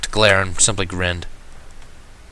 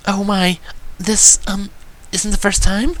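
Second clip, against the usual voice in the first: high, timid worried voice; gentle pony voice, anxious; 11 semitones higher, slower than usual. A narrator's reading. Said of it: "sounding worried".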